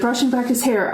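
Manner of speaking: ordinary delivery, nothing marked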